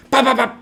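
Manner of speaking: loud, high burst of mock gunshots; imitated gunfire. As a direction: fire off shots with the voice.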